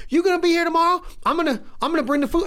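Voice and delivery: high, fast put-on woman's voice, rushing her plans; eager and quick, promising the food; imitating the woman. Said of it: "She's like".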